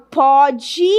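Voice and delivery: PODE with an open, stressed ó; stretched open-o "pode"; present-tense open O. Shown as a demonstration. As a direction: open up the first vowel of "pode". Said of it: "é presente".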